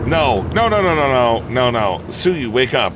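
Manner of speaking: high, loud desperate no's; frantic denial over a fallen friend; panicked pleading. Acted. Finds friend struck down.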